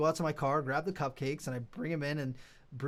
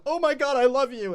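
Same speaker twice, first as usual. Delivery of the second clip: high, loud delighted gush; acted-out overjoyed thanks; gushing gratitude; 9 semitones higher, 8 dB louder than usual. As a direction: exclaim it like a rescued, starving fan. Acting out grateful vendors' reaction.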